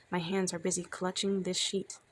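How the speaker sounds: ordinary delivery, nothing marked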